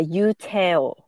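Yu Teo said slow and careful; careful Korean pronunciation; correct Korean name. Shown as a demonstration. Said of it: "So it's Teo, um, not Ta".

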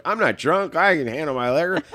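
put-on loud, high, indignant drunk; mock drunk protest. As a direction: insist you're sober, clearly tipsy. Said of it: "And I'm like".

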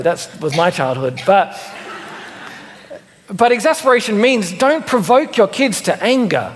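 ordinary talk

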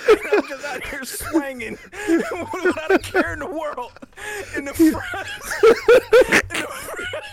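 loud, high, laughing through the words; cracking up. Real, not acted. Storyteller tells friends of his carefree run past customers, cracking up. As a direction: keep going while bursting with laughter.

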